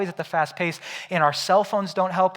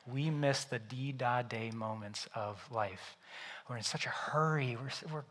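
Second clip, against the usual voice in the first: whisper; 5 semitones lower, 12 dB quieter than usual. Real, not acted. Quiet reflection in sermon.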